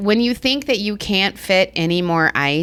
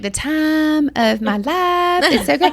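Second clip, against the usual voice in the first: slow, drawn-out Southern twang; host mimicking the guest's Southern accent, slow drawl; 8 semitones higher, slower than usual. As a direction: imitate her Southern drawl slowly. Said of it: "I just love your accent".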